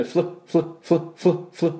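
フル as a short English "fl", repeated in separate bursts; consonant cluster drill. Shown as a demonstration. Teacher drilling the double consonant, clipped "fl" cluster. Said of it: "flyはダブルシーなんで".

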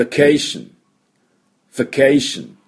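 vacation high, English V and strong CAY; repeat-after-me model. Shown as a demonstration. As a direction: give vacation as a drill word, va-CAY-tion. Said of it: "もう一回いきましょ".

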